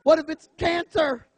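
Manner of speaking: mock panic at the hairy friend; panicked. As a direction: freak out about cancer. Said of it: "And I'm like".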